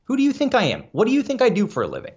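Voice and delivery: mock outrage, pitched high; who do you think I am, indignant and high. Real, not acted. Complaining about late Sunday games.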